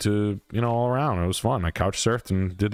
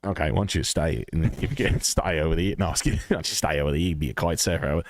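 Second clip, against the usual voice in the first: lightly mocking Australian accent, low; low imitation of an Australian accent, laughing a little; 5 semitones lower than usual. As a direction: slip into a put-on Aussie accent. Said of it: "Girls like your accent".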